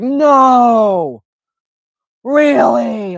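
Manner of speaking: loud, high mock amazement; over-the-top sarcasm. Shown as a demonstration. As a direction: draw it out in exaggerated fake disbelief. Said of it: "over the top, like, really sarcastic".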